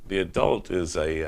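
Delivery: ordinary talk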